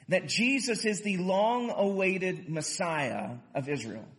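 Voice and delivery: usual speaking voice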